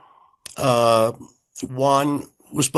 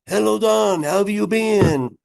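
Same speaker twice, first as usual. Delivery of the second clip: put-on German accent; 7 semitones higher than usual. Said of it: "in a rhythmical".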